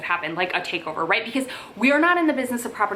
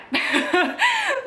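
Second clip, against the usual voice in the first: high burst of laughter. Real, not acted. Laughs at her own story.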